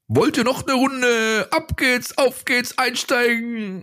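high, slow barker calling riders aboard; fairground ride announcer. Pretend. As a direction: hype up the ride like a funfair operator. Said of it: "Passend zum Cannstatter Volksfest". (Kirmes-Fahrgeschäft-Ansager, hoch und langgezogen)

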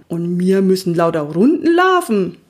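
appalled pony voice; horrified pony. A narrator's reading. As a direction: protest in pony horror. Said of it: "Toni blähte entsetzt die Nüstern". (entsetztes Pony)

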